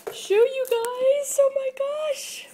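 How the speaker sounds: high, loud thrilled squeal; giddy high-pitched oh my gosh; excited squeal. Real, not acted. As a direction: squeal with real delight at the package. Thrilled unboxing reveal.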